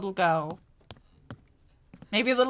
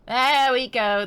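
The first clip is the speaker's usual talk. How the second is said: louder, triumphant; excited; 3 semitones higher, 7 dB louder, slower than usual. Real, not acted. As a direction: call it out in triumph. Game path found.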